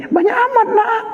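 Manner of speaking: high surprised exclamation; surprised mother. Retold or imitated. Mother startled by her son taking ten meatballs, high surprised voice. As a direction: squeal in surprise at the plate.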